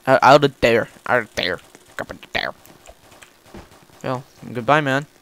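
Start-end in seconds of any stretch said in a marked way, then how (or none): 0.1-2.5 sinking lower and softer to almost nothing; low mumble dying out; trailing repetition. Pretend. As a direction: let each repeat drop in pitch and fade away. Repeats phrase, sinking to a mumble.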